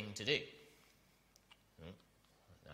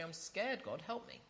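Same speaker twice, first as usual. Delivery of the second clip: soft plea; 5 semitones higher, 5 dB quieter than usual. Pretend. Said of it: "come to your Father and say".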